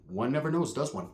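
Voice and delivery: mock-posh aside to the arguing cousin. Softly mock-posh reply to cousin. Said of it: "I'm like".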